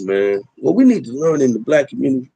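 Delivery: usual speaking voice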